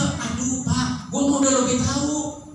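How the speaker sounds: put-on know-it-all teenager talking back, high-pitched; cocky youngster. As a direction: brush off the old-timer.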